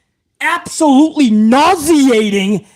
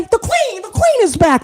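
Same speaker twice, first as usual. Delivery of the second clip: high squealing fan cheering, much higher; mocking excited fan; 8 semitones higher than usual. Mocking online fans cheering that the queen is back. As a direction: ham up a giddy fangirl shouting woo. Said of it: "you know what else they say".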